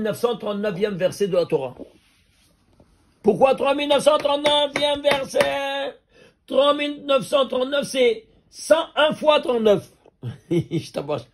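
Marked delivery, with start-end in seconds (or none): 3.2-9.9 excited. Real, not acted. Reveals verse number with laugh.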